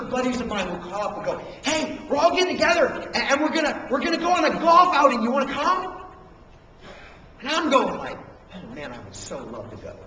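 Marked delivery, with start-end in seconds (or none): 1.6-6.0 loud, raised buddy-on-the-phone voice; eager invitation. Acted. Pastor buddy phoning about a golf outing, eager and loud. 8.5-10.0 low, soft, reluctant turn-down; mock regret. Student turning down his buddies' golf outing, low regretful voice.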